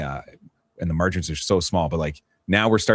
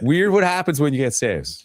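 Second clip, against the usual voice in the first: much higher, playfully incredulous voice; high-pitched repeat; 9 semitones higher, 3 dB louder than usual. Pretend. Analyst repeating his point to a mock-shocked host, high-pitched.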